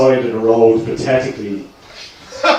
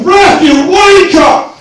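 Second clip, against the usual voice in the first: much higher, much louder, drawn-out shout at the ref; football terrace yell; 12 dB louder, much slower than usual. Retold or imitated. At Stamford Bridge yelling at the referee, a stretched-out jeer. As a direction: bellow abuse from the stands. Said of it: "in Stamford Bridge saying things like".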